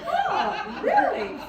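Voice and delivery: squealed high, loud mock surprise. To a doubting guest, loud mock surprise. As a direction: feign shock, way up high. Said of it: "I just go".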